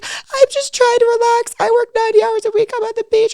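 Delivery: mocking the girl. Mocking the defensive girl in a high whiny voice. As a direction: put on a high, prissy voice to mimic her. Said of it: "She's like".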